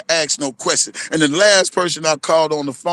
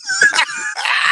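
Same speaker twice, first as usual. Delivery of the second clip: high whooping laugh of victory; triumphant laugh. Real, not acted. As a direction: crow with a gleeful laugh. Gloating over keeping the car.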